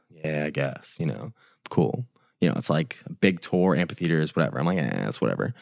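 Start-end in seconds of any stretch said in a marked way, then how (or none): 0.1-2.0 softer, noncommittal shrug; unimpressed. Retold or imitated. Telling a friend about the festival tour, unimpressed. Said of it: "Kinda being, like, an asshole about it". 4.8-5.5 low, softer verbal shrug; dismissive. Retold or imitated. Answering a friend about a big tour, dismissive. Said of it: "Kinda being, like, an asshole about it".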